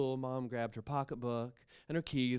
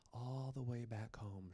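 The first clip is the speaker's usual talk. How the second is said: ordinary delivery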